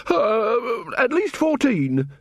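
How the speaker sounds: high, drawn-out hedging; aggrieved shopkeeper. A narrator's reading. Robbed man inflates his loss, aggrieved. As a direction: stretch the claim out in a high voice.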